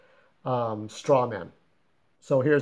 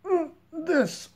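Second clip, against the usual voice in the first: much higher, strained, broken voice with a cry in it; deformed man's voice. Shown as a demonstration. Creator demos how scarred Jerry now talks, strained and broken. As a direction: push the sound out like a ruined jaw. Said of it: "So he talks like".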